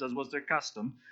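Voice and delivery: ordinary talk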